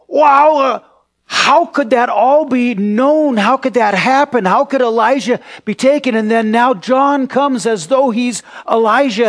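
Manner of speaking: high, loud, incredulous wonder; startled amazement. Retold or imitated. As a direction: re-enact being astounded, voice pitched right up. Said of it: "so startling to me and amazing".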